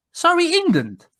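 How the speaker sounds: high, incredulous caller voice; imitating the caller. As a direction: ask it up high, amazed. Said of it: "He goes".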